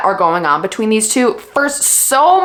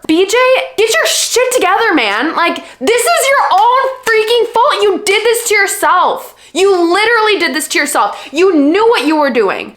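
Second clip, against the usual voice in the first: exasperated scolding; 9 semitones higher than usual. Real, not acted. Scolding a book character.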